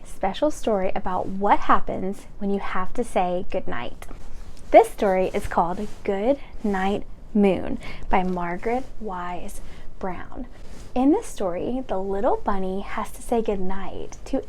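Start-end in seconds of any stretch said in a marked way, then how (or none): none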